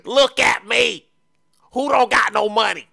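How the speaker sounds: high, loud bragging shout; boastful shout. Acted. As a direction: shout it out to the haters.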